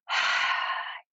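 long audible exhale; deep calming sigh. Shown as a demonstration. Parent showing kids a calming breath, audible sigh. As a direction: let the breath out slowly and audibly. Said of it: "you take your deep breaths and you just".

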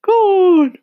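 very high loud urging; eager. A narrator's reading. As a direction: push him on, excited. Others urge, eager.

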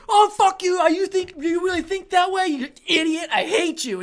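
mock angry rant. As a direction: rant like a starving dieter losing it. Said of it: "It's like".

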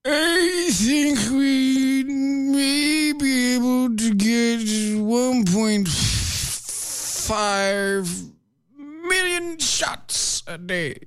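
drawn way out, high-pitched, fading at the end; deliberately trailing. Pretend. Co-host hamming up a quote for the show, deliberately drawn-out and trailing. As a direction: stretch every word to absurd length, high, then trail away. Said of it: "you trailed there".

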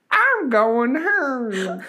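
high, slow granny voice; drawn-out old lady whine; man doing a woman's voice. Pretend. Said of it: "Sounds like an old lady, a granny".

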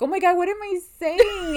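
shocked thought. Retold or imitated. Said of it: "in my head, I was like".

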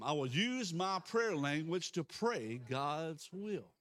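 slow, deliberate congregational confession; declaration of faith. Real, not acted. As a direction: stretch it out so all can repeat.

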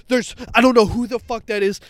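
loud, high, frantic blurt; panicked shout. Retold or imitated. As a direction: burst in shouting like you just fled a ghost. Panicked telling friends about ghost.

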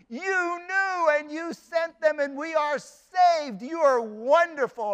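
thrilled granddaughter. Retold or imitated. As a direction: read it like a young bride bursting with gratitude.

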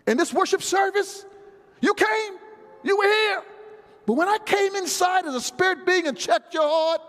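high-pitched, insistent preacher voicing God; mock divine rebuke. God confronting Cain over his worship, high insistent rebuke.

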